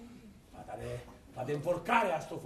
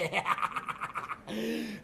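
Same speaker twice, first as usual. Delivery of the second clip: mad cackle; 5 semitones higher than usual. Acted. Miser laughs madly.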